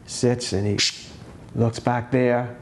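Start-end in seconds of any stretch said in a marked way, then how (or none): none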